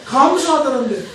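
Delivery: ordinary delivery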